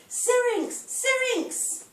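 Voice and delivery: high-pitched calling of a name; performed cry to the treetops; mock scream. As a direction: call it out high, like a lovesick pursuer. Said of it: "He screams my name to the treetops".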